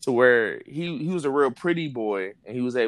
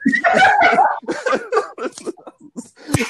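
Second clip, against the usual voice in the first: big real laugh, loud and high; loud high laughter; 8 dB louder than usual. Real, not acted. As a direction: burst out laughing. Laughing at his own story.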